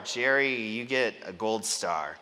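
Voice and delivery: usual speaking voice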